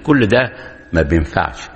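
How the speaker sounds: ordinary talk, nothing marked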